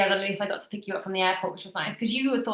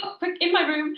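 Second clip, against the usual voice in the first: much higher-pitched imitation of a flustered boss; retold urgent ushering; 11 semitones higher than usual. Boss to employee in tears, high urgent ushering.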